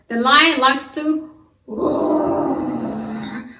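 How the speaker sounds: slow drawn-out mock roar; lion roar. Shown as a demonstration. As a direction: stretch it into a roar. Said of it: "guess what the lion likes to do".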